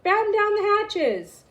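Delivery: slow, high storm command; shouting. A narrator's reading. Braidbeard orders the crew in the storm, slow and high. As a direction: bark it over the wind. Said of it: "Braidbeard shouted".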